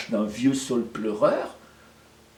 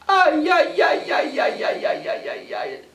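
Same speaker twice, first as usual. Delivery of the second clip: wailing lament. Acted. Finds her husband dead.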